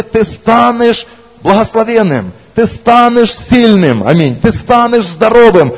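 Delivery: emphatic blessing. Acted. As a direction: proclaim each word slowly like a blessing. Preaching promises.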